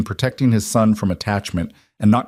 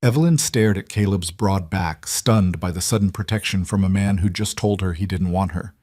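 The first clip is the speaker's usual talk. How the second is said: ordinary delivery, nothing marked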